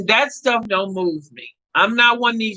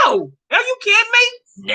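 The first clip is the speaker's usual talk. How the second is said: high, incredulous are you kidding me; mock disbelief; 11 semitones higher than usual. Telling ex who wants her back, high mock disbelief. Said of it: "I'm like".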